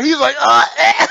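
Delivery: loud, high mock groan, laughing; mimicked squashed grunt. Man mimicking the little guy he rolled onto, comic strained grunt. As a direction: imitate someone getting squashed.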